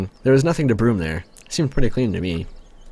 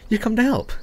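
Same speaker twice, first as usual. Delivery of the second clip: lightly voiced girl, higher; a hint of a cheerful young girl; girl's voice, lifted high; Slavia's voice; 11 semitones higher than usual. Voicing Slavia.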